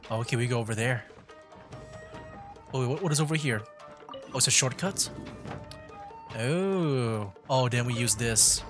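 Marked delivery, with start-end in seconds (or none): none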